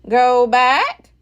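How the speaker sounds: loud parrot squawk; Polly the parrot warning. A narrator's reading. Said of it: "she squawked".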